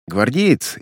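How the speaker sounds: usual speaking voice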